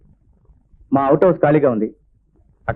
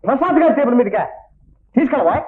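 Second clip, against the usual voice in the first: high-pitched, affronted demand; indignant questioning; 10 semitones higher than usual. Acted. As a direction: throw the question back, outraged. Slighted manager challenges the errand.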